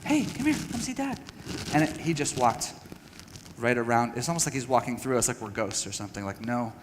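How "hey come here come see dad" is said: put-on higher and softer, gently coaxing a child; imitated soft call to his son; coaxing a toddler. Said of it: "And we get down, like".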